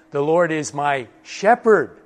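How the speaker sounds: shepherd set apart and lifted; stress on shepherd. Shown as a demonstration. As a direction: pause slightly, then punch shepherd. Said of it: "He's my shepherd".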